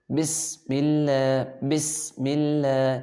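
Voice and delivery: slow "بسم" with a long hissing, whistled s; whistling seen. Shown as a demonstration. Teacher shows pupils the letter seen, whistling s in "بسم". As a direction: hold the whistle on the س of "بسم اللہ". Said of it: "سین کی ادائیگی میں سیٹی کی آواز نکالیں گے".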